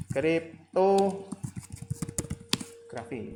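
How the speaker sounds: "Kriptografi" very slowly, broken into parts with pauses; drawn-out term. Real, not acted. Lecturer showing students the term, drawn out slowly. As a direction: stretch out "Krip-to ... grafi" piece by piece.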